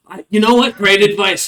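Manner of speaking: high and loud, caught off guard; flustered rat folk. Acted. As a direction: blurt it out, scrambling to agree.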